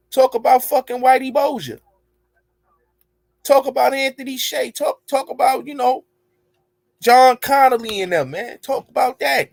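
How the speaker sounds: high, pressing call-out; fired-up rant, pitch way up; heated challenge. Real, not acted. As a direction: push him to talk about it, voice up high. Heated challenge to a rival rapper.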